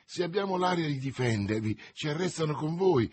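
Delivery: ordinary delivery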